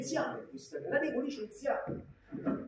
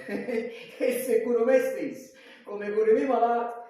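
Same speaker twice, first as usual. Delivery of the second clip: laughing; 8 dB louder than usual. Acted. Doctor laughing at own joke.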